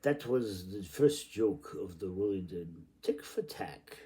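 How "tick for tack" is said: ordinary delivery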